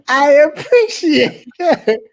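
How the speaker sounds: laughing through the words; laughing and grateful. Real, not acted. Laughs at granny voice joke.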